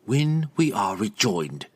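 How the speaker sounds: slow, icy condition; chilly, measured reminder; cold. Acted. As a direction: lay down the terms coldly. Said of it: "Verna added coldly".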